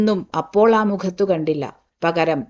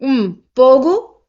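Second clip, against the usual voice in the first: slow, loud, higher command; 5 semitones higher, 4 dB louder, much slower than usual. Acted. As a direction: order her out like a towering elder. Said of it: "അയാൾ ആജ്ഞാപിച്ചു".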